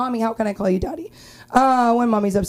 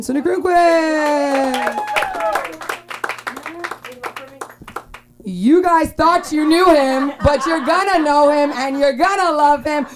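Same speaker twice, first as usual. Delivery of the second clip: loud, higher, stretched-out hype intro; hype announcer; 6 semitones higher, 6 dB louder than usual. Acted. Host hyping the next comedian to the crowd, booming. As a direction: bring the next act up big.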